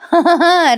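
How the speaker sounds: short high amused laugh; real laugh. Real, not acted. Host laughing while recapping the episode, amused burst. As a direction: laugh at the joke you just told.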